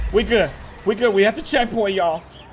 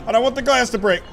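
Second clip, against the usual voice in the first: normal talking voice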